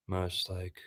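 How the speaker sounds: very soft, hesitant demo; trying to be quiet. Shown as a demonstration. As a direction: drop to a hushed, halting murmur. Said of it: "trying to be quiet".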